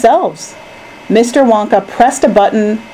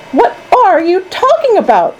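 high-pitched, incredulous question; baffled disbelief; 12 semitones higher than usual. A narrator's reading. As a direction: demand an explanation, bewildered. Baffled mother interrupts Charlie.

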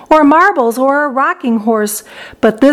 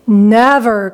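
ordinary delivery, nothing marked

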